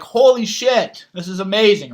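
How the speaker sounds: slow, loud, high burst of amazement; amazed realization. Pretend. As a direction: blurt it out as the breakthrough hits. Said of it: "suddenly you're like".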